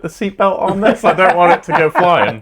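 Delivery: high, lightly voiced fussing parent; playfully fretting over the chicken; mock worried. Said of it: "was like".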